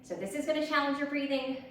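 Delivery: normal talking voice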